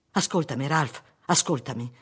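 plain reading voice

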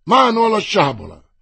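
loud, high battle cry; shouted command. A narrator's reading. Winter orders his troops to draw, shouting. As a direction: bark the order to draw sabres. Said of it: "la voce di Winter che gridava".

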